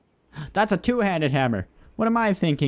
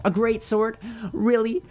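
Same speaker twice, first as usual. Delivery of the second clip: much higher, mock-incredulous merchant; sarcastic shopkeeper; 8 semitones higher than usual. Shopkeeper mocking a customer's big order, high and sarcastic. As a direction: tease the customer like a cartoon clerk.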